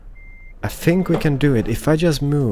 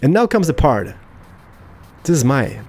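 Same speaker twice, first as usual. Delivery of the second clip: ordinary talk, nothing marked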